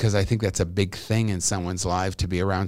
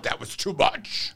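Bill Maher; 10 semitones higher, 4 dB quieter, slower than usual. Retold or imitated. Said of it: "he goes, he goes".

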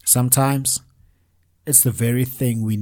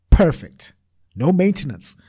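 breezy, higher and louder, pleased with himself; smug self-assurance; 5 semitones higher, 5 dB louder than usual. Retold or imitated. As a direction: toss it off like a sure win. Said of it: "So I thought".

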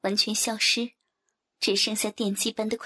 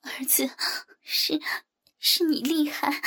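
halting, higher, breathy voice; small, meek voice; 5 semitones higher, slower than usual. A narrator's reading. Mother conceding to son, small meek voice. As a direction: stammer it out slowly and meekly. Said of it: "我低头小声说".

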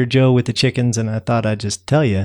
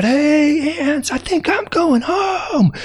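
high, calling shout to faraway ants; high shouted call across the snow; slower than usual. Acted. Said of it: "and I shout out".

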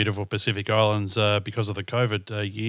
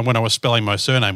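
ordinary delivery

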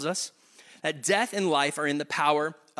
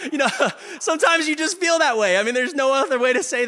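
high, louder laughing talk; 8 semitones higher, 4 dB louder than usual. Real, not acted. Preacher cracks up at his own bluntness, laughing. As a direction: laugh through the words.

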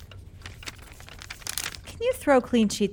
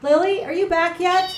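higher and louder, calling to another room; raised voice calling for someone out of sight; 9 semitones higher, 6 dB louder than usual. Acted. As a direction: call up the stairs to find her. Calling through the house to Lily.

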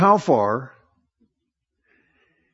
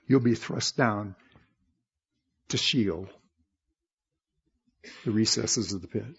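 much softer, grave and lower; solemn low verdict; 4 semitones lower, 7 dB quieter than usual. Real, not acted. Preacher answering Satan's boast, soft and grave. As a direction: let the judgment fall quietly.

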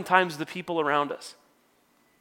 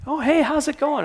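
mock sugary hello, pitched higher; a lightly voiced fake-nice neighbour; fake friendly greeting; 8 semitones higher than usual. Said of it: "the North Dakota nice thing".

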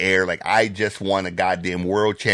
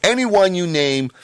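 excited rant; 6 semitones higher than usual. Retold or imitated. Husband to his wife about the movie scene, high excited raving rant. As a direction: gush over the scene like a fan. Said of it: "And I was like".

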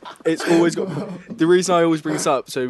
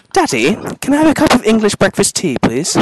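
high, loud, hammed-up Southern English child; mock well-spoken Southern English, much higher and louder; Southern English kid; 8 semitones higher, 10 dB louder than usual. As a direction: plead in an exaggerated proper Southern accent. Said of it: "And to me, they go".

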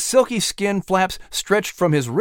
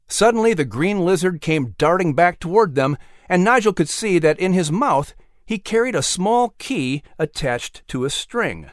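usual speaking voice

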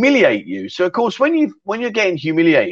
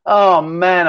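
ordinary talk

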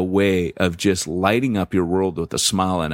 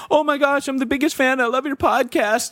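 much higher, starstruck fangirl squeal; gushing fan. Pretend. Imaginary fan meeting the host, high starstruck gushing. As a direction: play an overexcited fan meeting a hero.

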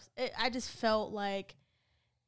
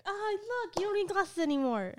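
mock cheerful eyes; 10 semitones higher than usual. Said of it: "my eyes are gonna be like".

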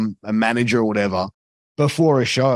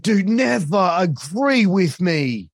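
exasperated scolding; 7 semitones higher, much slower than usual. Retold or imitated. Scolding rookie co-commentator.

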